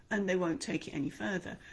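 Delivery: ordinary talk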